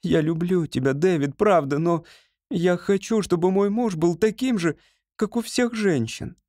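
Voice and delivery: Ruth's voice. A narrator's reading. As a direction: lift the voice for Ruth as she speaks.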